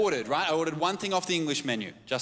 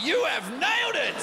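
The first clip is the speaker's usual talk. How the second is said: delighted exclaim; 10 semitones higher, 3 dB louder than usual. Retold or imitated. Delighted quote to waitress.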